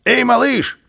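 high, loud hail; high-pitched parting holler; calling out. A narrator's reading. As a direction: shout to get someone's attention. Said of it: "крикнул Карлсон".

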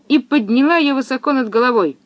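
plain reading voice

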